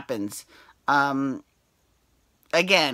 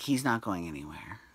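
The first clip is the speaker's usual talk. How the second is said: hushed, secretive whisper; 7 semitones lower, 8 dB quieter than usual. Retold or imitated. Quoting the CBS head.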